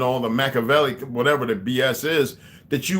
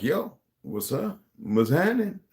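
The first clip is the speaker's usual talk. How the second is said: casual greeting; 6 semitones higher, slower than usual. Retold or imitated. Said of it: "I'm like".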